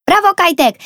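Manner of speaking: very high, loud cheer; cheering call. A narrator's reading. Sister cheers brother riding off, high loud cheer. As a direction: cheer him on. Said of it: "zawołała".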